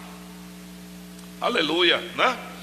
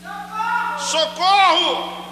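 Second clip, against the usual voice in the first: high, drawn-out scream for rescue; shouted cry for help; 11 semitones higher, much slower than usual. Pretend. Congregant acting someone drowning, long high shout for help. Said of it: "Grite aí".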